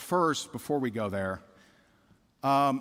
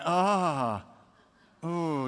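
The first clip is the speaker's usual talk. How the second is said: ordinary talk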